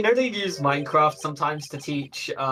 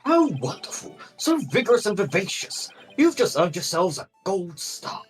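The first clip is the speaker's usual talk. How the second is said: delighted pompous professor, higher; viking; 6 semitones higher than usual. Acted. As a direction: praise them grandly, like a Viking scholar. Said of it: "what Viking voice do I give him".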